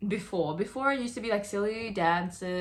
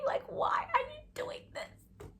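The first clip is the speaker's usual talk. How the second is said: high, breathy, whimpering plea; pained plea; 4 dB quieter than usual. Real, not acted. Begging animal abusers to stop, high and pained. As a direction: ask it as if close to tears.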